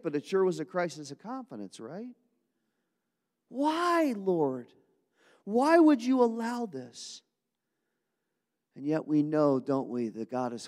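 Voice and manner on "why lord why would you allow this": anguished plea. Acted. Crisis of confidence.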